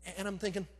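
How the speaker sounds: normal talking voice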